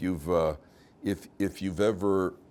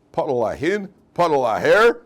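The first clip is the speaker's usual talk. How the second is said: mock miracle command; 6 semitones higher, 10 dB louder than usual. Rabbi in the joke orders the puddle apart, booming. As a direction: command the puddle like a wonder-worker.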